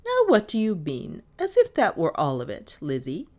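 mildly sharp questioning from a mistress; sharp query. A narrator's reading. Mistress questioning her maid over breakfast mail, sharply. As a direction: pull the maid up with a pointed question. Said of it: "queried Miss Cornelia sharply".

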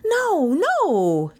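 high first cry, falling on the repeat; distressed boy's cry. A narrator's reading. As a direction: plead innocence, starting high. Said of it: "Kay cried".

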